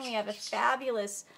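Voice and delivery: ordinary talk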